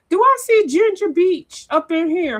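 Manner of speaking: high, loud, delighted call-out; light up at a friend's name; excited greeting. Real, not acted. Spots a friend in the live chat, excited.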